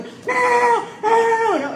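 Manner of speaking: mock panicked waving off; frantic refusal. Patient stops the chaplain at the door, high mock shout.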